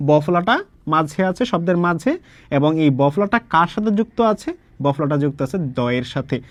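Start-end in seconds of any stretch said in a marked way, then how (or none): none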